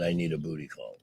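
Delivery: soft imitating tipsy guy; quiet mock horny guy. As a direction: mutter it like a buzzed guy. Voicing the buzzed guy.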